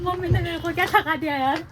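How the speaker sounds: mock-outraged whine at mum; playful complaint. Real, not acted. Daughter mock-complains mum cheated her, high teasing whine.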